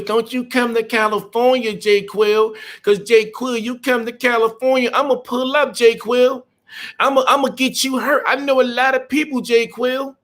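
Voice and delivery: high, small mock-tough kid voice; little tiny voice. Trucker mocks young driver's threat in a tiny high voice. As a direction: squeak the threat out like a little boy playing tough. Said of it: "In a little tiny voice".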